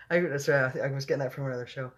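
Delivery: usual speaking voice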